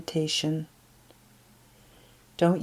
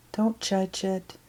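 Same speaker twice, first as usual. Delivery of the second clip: ordinary delivery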